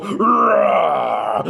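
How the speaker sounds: big drawn-out lion roar. Acted. The lion roaring at the flying monkeys, roaring. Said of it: "The lion roared".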